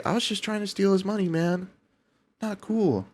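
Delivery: sulky mock scammer. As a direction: pout it like a hurt scammer. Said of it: "He's like".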